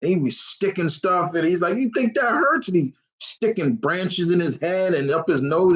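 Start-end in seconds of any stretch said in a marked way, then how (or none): 1.8-2.7 high-pitched imitation of a crazy villain; villain's taunt. Crazy villain sticking branches in himself, imitated high-pitched taunt.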